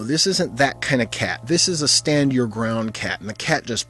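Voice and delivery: ordinary delivery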